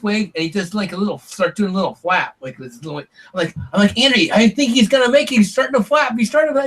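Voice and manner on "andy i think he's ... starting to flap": high-pitched, urgent retold report; excited hope. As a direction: relive the thrill, pitched well up.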